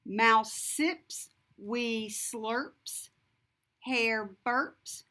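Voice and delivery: ordinary delivery, nothing marked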